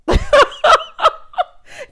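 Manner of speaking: real laugh that breaks out high and loud. Real, not acted. Host cracking up at Karen's slick move, loud laugh. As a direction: burst out in a loud, high cackle.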